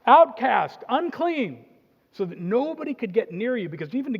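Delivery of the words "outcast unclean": loud warning cry of outcast, unclean; leper calling out. Retold or imitated. Leper warning the crowd away, loud calling cry. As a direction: shout it so nobody comes near. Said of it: "you would say in Aramaic".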